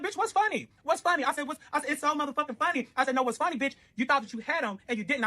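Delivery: high, sharp challenging taunt; confrontational. Retold or imitated. Narrator confronting the ex at her door, high taunting. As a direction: get in her face about it.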